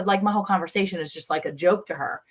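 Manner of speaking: ordinary talk, nothing marked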